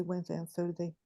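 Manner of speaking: ordinary talk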